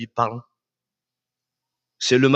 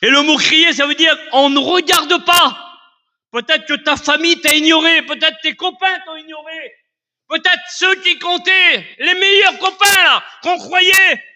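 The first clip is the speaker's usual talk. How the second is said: loud, high, impassioned sermon; fiery pulpit preaching; preaching at full force; 14 semitones higher, 5 dB louder than usual. Acted. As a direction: preach it out, climbing and hammering each line.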